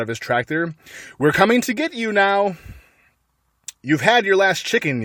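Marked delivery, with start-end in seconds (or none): 1.2-2.6 yelling. A narrator's reading. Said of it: "yelled Bunce". 3.8-5.0 yelling. A narrator's reading. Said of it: "yelled Boggis".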